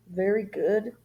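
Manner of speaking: usual speaking voice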